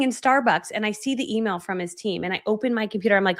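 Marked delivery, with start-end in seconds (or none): none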